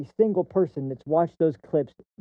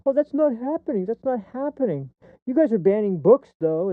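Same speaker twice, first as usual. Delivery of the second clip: mocking opponent; 6 semitones higher than usual. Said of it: "every time I hear, like".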